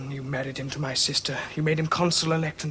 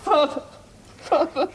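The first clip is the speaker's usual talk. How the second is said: sobbing; 12 semitones higher, slower than usual. Acted. Son sobs to father.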